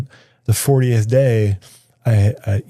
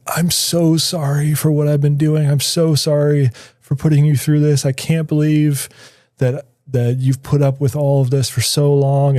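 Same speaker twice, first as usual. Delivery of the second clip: pitched higher, mock tearful plea; retelling the addict's sobbing apology, higher and hammed-up; imitating tearful apology; 5 semitones higher than usual. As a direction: voice him as a man breaking down, lightly. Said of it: "he starts bawling".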